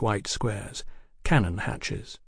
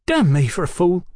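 higher, indignant outburst; exasperated cursing; 7 semitones higher, 4 dB louder than usual. A narrator's reading. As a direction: curse yourself crossly, voice raised. Brian curses himself over uniform.

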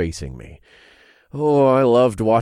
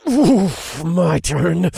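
strained, louder grunt through teeth; through clenched teeth; 8 semitones higher, 7 dB louder, much slower than usual. A narrator's reading. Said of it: "I hissed through clenched teeth".

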